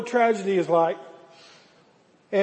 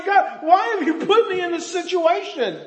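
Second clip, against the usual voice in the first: anguished cry to God; 8 semitones higher than usual. Acted. Elijah pleading with God over the dead boy, high and strained. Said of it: "is saying".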